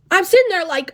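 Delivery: ordinary delivery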